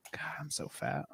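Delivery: hushed near-whisper to oneself; dismayed aside. Retold or imitated. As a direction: mutter it under the breath, dismayed. Retold private reaction to video.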